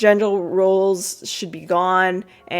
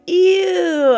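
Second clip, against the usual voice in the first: squealed ew, much higher and louder; high disgusted ew; 11 semitones higher, 6 dB louder than usual. Retold or imitated. Girl told she touched eyeballs, high disgusted squeal.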